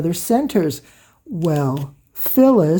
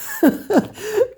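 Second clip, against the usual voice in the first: high-pitched real laugh; delighted laugh; 13 semitones higher than usual. Real, not acted. Delighted by all the fabric strips, high laugh.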